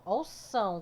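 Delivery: ordinary delivery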